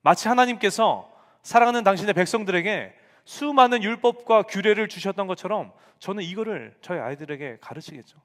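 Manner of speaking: usual speaking voice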